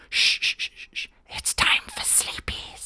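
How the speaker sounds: soft whispered shushing; hushed bedtime whisper; whispered lullaby. Pretend. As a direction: whisper him to sleep. Shushing Hitler to sleep.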